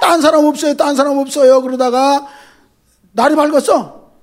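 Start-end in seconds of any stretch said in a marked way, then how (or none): 0.0-1.6 loud calling out for someone else; shouting for help. Retold or imitated. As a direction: yell into the dark for another helper. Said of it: "밤새". (다른 사람 찾으며 외치듯)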